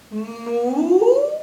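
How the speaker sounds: loud moo sliding upward; long rising cow call; questioning cow. Acted. Farmer won't accept cow's apology.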